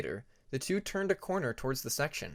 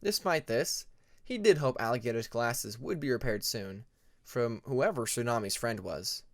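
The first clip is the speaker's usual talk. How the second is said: usual speaking voice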